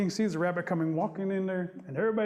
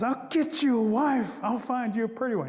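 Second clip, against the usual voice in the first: exasperated promise; 5 semitones higher, 4 dB louder than usual. Acted. Rabbit placating grumbling Possum, exasperated. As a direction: give in loudly to stop the nagging.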